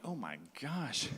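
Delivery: soft, slow retold dismay; quiet dismay. Trekker facing a huge hill, quietly dismayed. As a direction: say it slowly under your breath.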